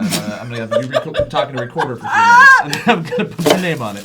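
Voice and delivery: laughing through a joke. Real, not acted. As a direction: joke while cracking up. Joking while laughing.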